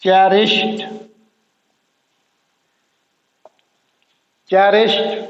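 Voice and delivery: चेरिश्ड ending in t, cherisht. Shown as a demonstration. Teacher demonstrating the t ending of चेरिश्ड for learners. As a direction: close चेरिश्ड on a t. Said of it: "दो letters एक sound".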